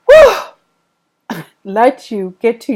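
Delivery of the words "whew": a long sigh into a high, loud whew; relieved whew. Real, not acted. Tarot reader composing herself with a loud whew. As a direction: blow out the emotion to steady yourself. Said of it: "I had to get myself together".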